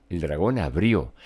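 ordinary delivery

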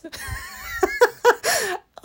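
real, very high shrieking laugh. Real, not acted. Cracking up while retelling her wife's "What?", shrieking laugh.

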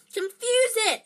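high, loud, desperate order; frantic high-pitched cry. A narrator's reading. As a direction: shout it urgently to a friend. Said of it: "Harry said desperately to Ron".

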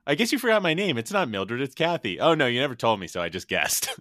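high, loud hammed-up imitation; imitating Mitch. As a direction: play the clueless guy calling after her, loud and high. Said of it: "He goes".

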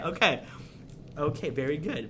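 usual speaking voice

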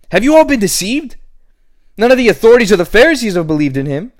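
high, fast, contemptuous religious leader; scornful Pharisees. Acted. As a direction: scold the crowd like a haughty Pharisee. Pharisees scolding the crowd.

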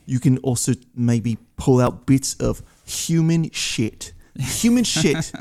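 slow, deliberate mock British accent. Shown as a demonstration. Parodying a cigar reviewer naming notes, British accent. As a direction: savor it in a pretentious British accent. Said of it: "Do it in a British accent".

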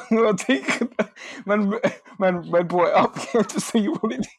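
loud, high, cracking up; laughing protest. Real, not acted. Calling out a friend for bringing it up, laughing protest. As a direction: squeal the insult through real laughter.

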